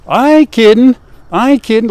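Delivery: high and loud, pressing the point; emphatic insistence. Real, not acted. Farmer insisting to viewers he isn't kidding. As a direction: insist it's true, almost laughing.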